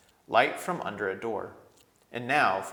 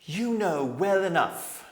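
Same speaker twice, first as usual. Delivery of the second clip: slow, higher kingly voice; stern king; 9 semitones higher, 7 dB louder, slower than usual. A narrator's reading. Young king rebuking his council, slow and stern. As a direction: weigh each word like a king.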